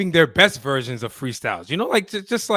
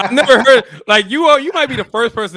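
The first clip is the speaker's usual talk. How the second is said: loud, high disbelief; much higher and louder, amazed; incredulous; 8 semitones higher, 7 dB louder than usual. Real, not acted. Incredulous rising rant.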